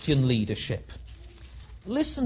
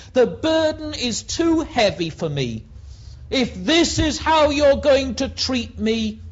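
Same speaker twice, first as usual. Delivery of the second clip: loud, high, anguished protest to God; Moses crying out; 6 semitones higher, 5 dB louder than usual. Acted. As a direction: hurl the complaint at heaven. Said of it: "Listen to Moses".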